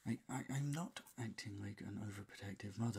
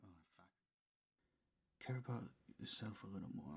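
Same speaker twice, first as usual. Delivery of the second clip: ordinary talk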